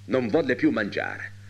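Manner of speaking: ordinary delivery, nothing marked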